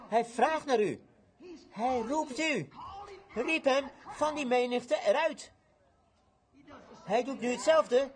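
high, drawn-out proclamation; fervent preaching. Acted. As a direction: preach it slowly with long pauses. Preaches at full force.

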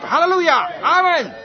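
high, loud cry of praise; exultant shout. Retold or imitated. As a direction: shout it out like an overjoyed young believer. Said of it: "Hij schreeuwde het uit". (juichend uitschreeuwen)

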